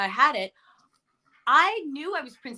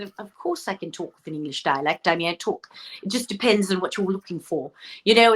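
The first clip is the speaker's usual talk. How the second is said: lower-pitched English accent; 4 semitones lower than usual. Shown as a demonstration. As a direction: answer in a proper English accent. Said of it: "can you do an English accent".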